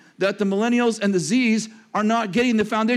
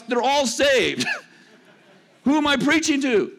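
laughing punchline; 6 semitones higher than usual. Real, not acted. Joking punchline to congregation.

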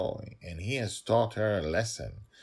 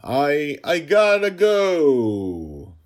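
much higher, loud, very slow stammer; a frightened genie trying to get away; nervous genie; 10 semitones higher, 13 dB louder, much slower than usual. Acted. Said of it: "This was the genie's voice".

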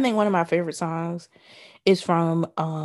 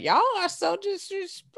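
exasperated; 13 semitones higher, 4 dB quieter than usual. Retold or imitated. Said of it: "And I was like".

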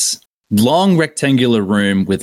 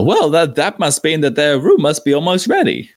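Bacchus voice; 7 semitones higher than usual. Acted. GM voices Bacchus, quoted after "he says.

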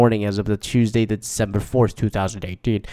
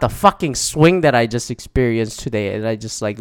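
ordinary talk

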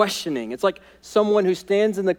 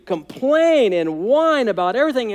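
normal talking voice

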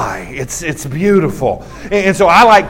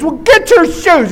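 high, slow mock yell; exasperated sponsor; 10 semitones higher, slower than usual. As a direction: drag out the imitated angry order. Acted sponsor ordering the kid back.

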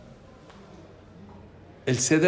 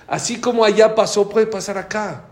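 loud, higher cry of warning; a preacher's closing alarm; imitated warning; 7 semitones higher, 7 dB louder than usual. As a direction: drive the final warning home. Rabbi's climactic warning.

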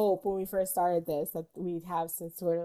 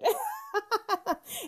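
a high rising laugh; 12 semitones higher than usual. Real, not acted. Host laughs remembering a good movie. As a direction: laugh upward, delighted.

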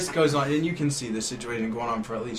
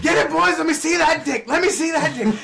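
much higher, louder mock rallying cry; rowdy egging on; 7 dB louder than usual. Himself urging the boys to grab him, loud. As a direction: whip the boys up like a drunk ringleader.

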